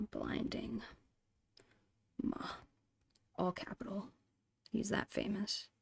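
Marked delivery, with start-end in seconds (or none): none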